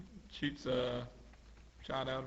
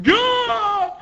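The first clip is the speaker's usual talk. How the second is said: high, loud burst; hammed-up battle yell; attack shout; 11 dB louder than usual. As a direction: yell it like a cartoon fighter. Acted attack shout from manga.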